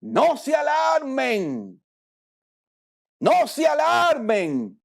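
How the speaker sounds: shouted slowly, word by word; a slow, commanding shout. Retold or imitated. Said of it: "¿Cómo fue que dijo?".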